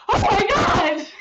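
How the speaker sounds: very loud, high cry of horror; shocked scream. Retold or imitated. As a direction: shriek it down the phone in shock. Sister's shocked reaction, acted out.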